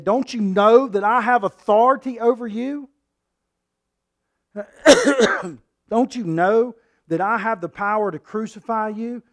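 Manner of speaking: loud and high, asserting authority; Pilate's boast. Acted. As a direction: shout down a prisoner who won't answer.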